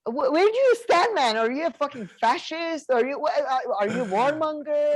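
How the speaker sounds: as if asking the villain outright where he stands; exasperated rhetorical rant. Real, not acted.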